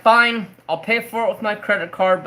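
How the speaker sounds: grudging dad. Retold or imitated. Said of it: "my dad's like".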